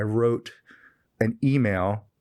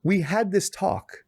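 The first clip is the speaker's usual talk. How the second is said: excited retelling; 7 semitones higher than usual. Retold or imitated.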